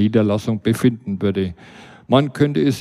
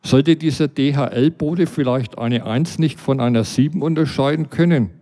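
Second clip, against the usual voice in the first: plain reading voice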